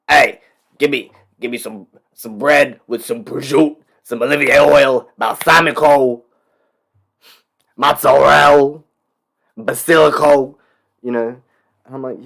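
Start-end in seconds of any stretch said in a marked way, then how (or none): 0.1-10.5 loud high hammed-up New York Italian deli order; mock Italian American accent. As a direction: belt it out like a cartoon Italian at the deli, stretching the food words.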